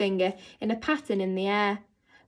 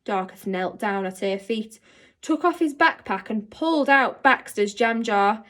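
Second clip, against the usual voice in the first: usual speaking voice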